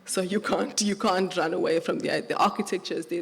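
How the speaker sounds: ordinary talk, nothing marked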